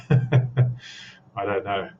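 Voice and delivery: very slow, drawn-out words. Shown as a demonstration. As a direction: stretch each word out slowly. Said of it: "maybe I should speak slower".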